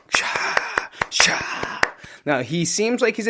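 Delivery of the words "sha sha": breathy clapping sound effect; voiced applause noise; mock clap noise. Shown as a demonstration. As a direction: make a clap with your mouth. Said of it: "we'll do a little clap noise for him".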